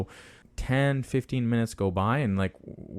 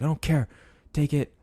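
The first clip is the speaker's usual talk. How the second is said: high imitation of a dismissive, angry neighbor; mock cursing; 6 semitones higher than usual. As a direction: cut him off in a raised pitch, retold. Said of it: "he's, like, cursing loud at me".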